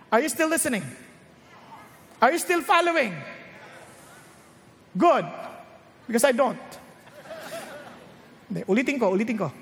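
ordinary talk

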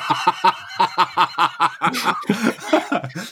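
long, high fit of laughter; helpless high laughing, drawn out; real laughter. Real, not acted. Storyteller cracking up at his own punchline, laughing.

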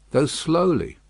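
ordinary delivery

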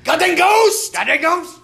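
imitating a kid shouting in a museum; kid yelling. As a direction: squeal it like a scared little kid. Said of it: "the only thing the kid yelled was".

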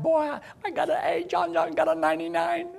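put-on high, puffed-up kid boasting; bragging schoolboy. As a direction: crow about the grade like a smug boy. Proud boy bragging about grade.

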